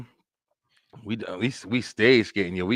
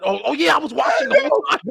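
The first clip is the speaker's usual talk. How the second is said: mock lifeguard; 13 semitones higher than usual. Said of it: "I'm like".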